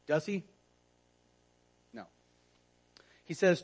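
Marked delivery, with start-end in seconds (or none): none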